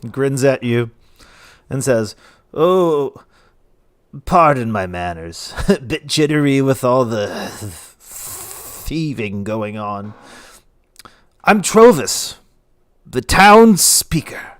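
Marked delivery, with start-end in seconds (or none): 2.5-14.5 slurred, drawn-out tipsy introduction; jittery drunk stretching his words; drunk town speaker. Acted. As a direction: introduce yourself with drunken pomp. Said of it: "He's obviously drunk as shit".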